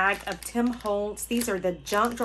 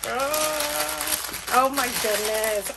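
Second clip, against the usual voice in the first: ordinary talk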